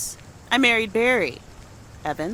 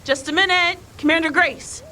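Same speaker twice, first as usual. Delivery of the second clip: higher voice calling across the house; 10 semitones higher, 4 dB louder than usual. Acted. Mother to her little commander, high calling voice. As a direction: call to a child playing commander. Calling to child mid-game.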